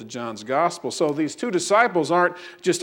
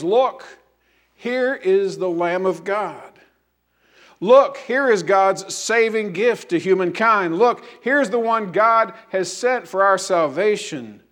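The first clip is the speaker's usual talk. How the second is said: higher, louder, slow proclaiming; exclaiming; 4 semitones higher, 4 dB louder than usual. Acted. John the Baptist proclaims to his disciples, exclaiming slowly. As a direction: announce it with wonder. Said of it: "John exclaims".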